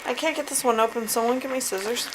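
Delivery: usual speaking voice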